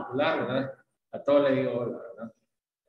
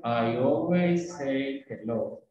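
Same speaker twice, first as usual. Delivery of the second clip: slow, words holding hands; linked, fluid; 2 semitones lower, much slower than usual. Shown as a demonstration. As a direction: draw it out smoothly, every word joined. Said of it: "lo dices agarraditos de la mano todo".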